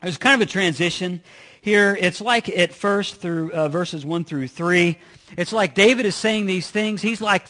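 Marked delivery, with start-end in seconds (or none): none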